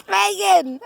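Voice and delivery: laughing through her name; nervous laughing call; panicked laugh. Real, not acted. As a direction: giggle it out, scared.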